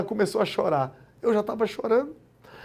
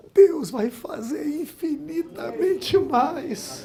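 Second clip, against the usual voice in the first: slow, higher declaration; solemn proclamation; 7 semitones higher, much slower than usual. Acted. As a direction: let each word land. Sermon proclamation.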